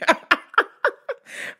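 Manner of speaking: high embarrassed laugh. Real, not acted. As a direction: squeal of laughter.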